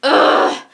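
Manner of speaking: loud, breathy ugh of frustration; exasperated groan. Acted. Fed up with her calm, a loud frustrated ugh.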